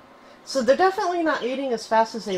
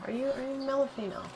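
ordinary delivery

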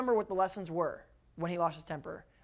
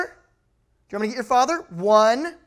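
loud, higher mock parent warning; parental threat; 5 semitones higher, 9 dB louder, slower than usual. Parent warns a misbehaving child by counting, raised threatening voice.

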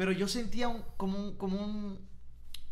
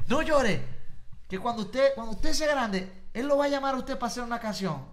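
loud, raised fatherly insistence; imitated dad, forceful and higher; firm consoling father; 5 semitones higher, 5 dB louder than usual. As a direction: promise it emphatically to a crying child. Father firmly consoling crying son.

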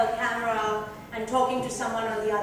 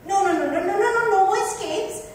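rising, louder string of no's; emphatic refusal; 8 semitones higher, 5 dB louder than usual. A narrator's reading. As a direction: shut every escape door, insistent. Narrator's fierce inner resolve.

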